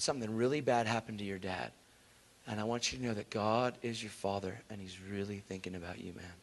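low, soft, earnest reassurance; gentle consoling. Retold or imitated. Preacher telling a kid God cares, retold gentle reassurance.

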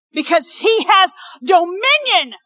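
loud, high, driving sermon delivery; full-force preaching. Acted. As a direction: preach with mounting urgency and punched key words.

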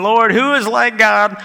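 earnest, insistent prayer; pleading. Acted. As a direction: plead with God for rescue. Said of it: "they're pleading".

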